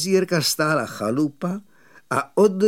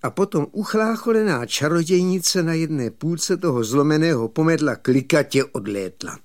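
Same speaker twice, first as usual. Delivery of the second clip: plain reading voice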